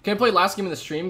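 high, fast eager asking; eager viewer voice. Pretend. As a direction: rush it like a keen fan begging. Reading out a viewer's chat request.

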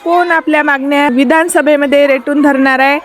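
loud, energetic reporter's sign-off; news report. Acted. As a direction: project it like a field reporter.

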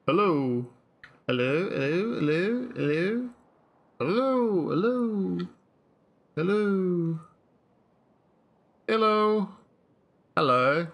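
playfully trying out British-style hellos; high, drawn-out accent experiments on a greeting; playful accent attempts. Playing with accented versions of hello.

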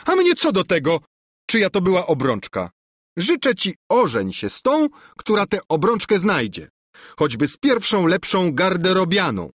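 high, loud spiteful princess; a princess taunting her rejected cousin. A narrator's reading. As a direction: spurn him with scorn. Princess spurns cousin's ring.

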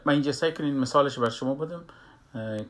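ordinary talk, nothing marked

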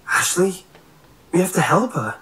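high, urgent plea; alarmed cry. A narrator's reading. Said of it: "Nick cried".

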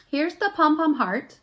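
normal talking voice

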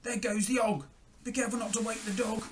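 high, put-on crook's voice; high-pitched burglar on the job; storybook thieves. A narrator's reading. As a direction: warn your accomplice as a cartoon villain.

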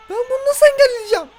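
high, loud whining cry; despairing wail. Real, not acted. Gamer wailing that he cannot stop the attacker. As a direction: wail in helpless frustration.

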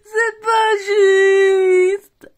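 very slow, loud wailing protest; loud tantrum wail; crying shout. Real, not acted. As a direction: howl it through sobs. Child tantrum.

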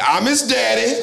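high, loud, laughing boast of a fake father; overeager fake dad. Dope fiend bragging to the car salesman, high and overeager. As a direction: ham up the proud daddy claim, way up high. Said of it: "He said".

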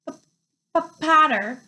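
stuttering hard on the first sound; nervous stammer. A narrator's reading. Nervous professor greeting Harry, stammering. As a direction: trip over the P before getting it out. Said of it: "stammered Professor Quirrell".

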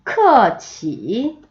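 크어치 very slow, each tone stretched out; tone drill. Shown as a demonstration. Teacher starting tone practice, 크어치 slow and drawn out. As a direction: draw out both tones of 크어치. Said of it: "성조 연습 먼저 해볼게요".

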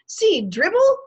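put-on very high, loud little-kid squeal; excited toddler. A narrator's reading. Toddler shows the guest a book, squealing proudly. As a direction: pipe up like a small child.

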